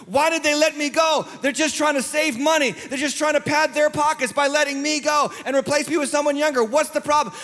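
high-pitched aggrieved protest; acted indignant complaint. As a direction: rant like someone unfairly let go. Voices a worker who was fired.

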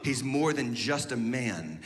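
ordinary talk, nothing marked

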